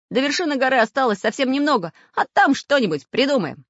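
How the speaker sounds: quick, high, loud encouragement; rally the group briskly; high-pitched, hurried pep talk; urgent rallying. A narrator's reading. Samodelkin urges his friends on.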